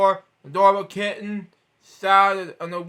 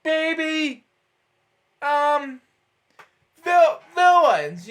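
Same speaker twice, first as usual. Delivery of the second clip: amazed; 8 semitones higher, much slower than usual. Real, not acted. Reacts to villains turned babies.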